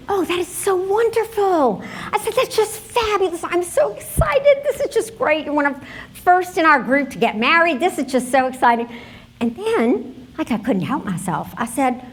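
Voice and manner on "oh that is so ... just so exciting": high-pitched, delighted gush over a friend's news; thrilled friend's squeal of congratulations, acted out; gushing excitement. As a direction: retell an over-the-top congratulation, pitch soaring.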